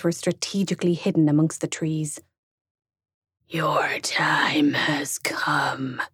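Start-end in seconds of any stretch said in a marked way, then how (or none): 3.5-6.0 slow menacing demon whisper; a demon hissing a threat; growling demon. Acted. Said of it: "the demon growled".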